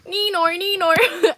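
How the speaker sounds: loud, high Australian "Neenor, Neenor"; Australian accent. Pretend. Greeting a super chatter in a high Australian accent. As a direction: sing out the name in a high Aussie twang. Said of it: "I have to say it with an Australian accent".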